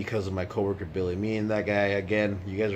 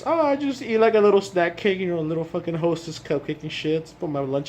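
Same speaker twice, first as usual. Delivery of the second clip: high, loud put-on voice for Billy, much higher than his own; imitating coworker Billy; 9 semitones higher, 6 dB louder than usual. Vlogger mimics coworker describing his snack-cake lunch, high put-on voice. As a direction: play the coworker, pitched way up and sheepish.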